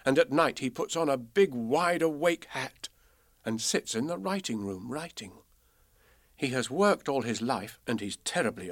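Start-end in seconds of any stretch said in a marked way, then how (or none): none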